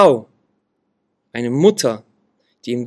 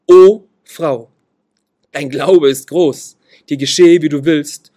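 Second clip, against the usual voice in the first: O Frau called out louder, dein Glaube ist groß slow; a preacher voicing Jesus, louder and solemn; Jesus' solemn words; 7 dB louder, slower than usual. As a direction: proclaim it as Jesus, with awe. Voices Jesus praising the woman.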